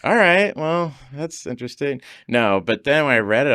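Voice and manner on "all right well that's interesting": mock polite doubt. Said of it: "I was like".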